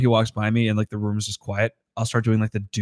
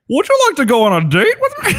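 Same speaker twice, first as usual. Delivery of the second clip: much higher, louder nervous ask; Don Knotts impression; 6 dB louder than usual. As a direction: squeak out the invitation like Don Knotts. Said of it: "Don Knotts asking out a garbage man".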